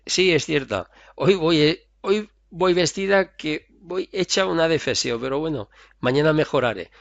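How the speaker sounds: playful negative assertion; cheerful self-deprecating agreement. Acted. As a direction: laugh off the insult. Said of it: "Eso es una aserción negativa".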